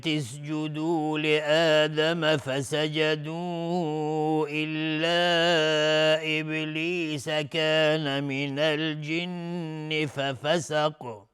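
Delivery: slow, melodic tartil chanting; Quran recitation. Acted. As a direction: draw out every verse with a flowing chant. Recites next verse melodically. (تلاوت آهسته و آهنگین قرآن)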